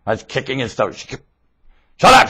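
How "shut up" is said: short, loud, high shout; snapped order. Retold or imitated. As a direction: bark it like a fed-up mother. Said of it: "she kept saying".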